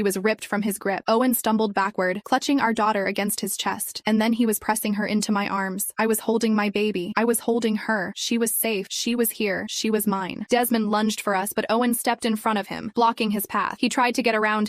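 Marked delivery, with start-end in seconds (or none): none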